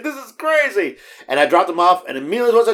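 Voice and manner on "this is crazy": high, laugh-filled retelling; laughing in disbelief. Retold or imitated. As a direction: crack up while quoting your own reaction. Amused reaction to coincidence.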